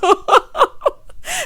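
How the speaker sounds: loud high burst of laughter; laughing. Real, not acted. Host laughing at her own lyric quote, loud high laugh. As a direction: crack up at your own joke.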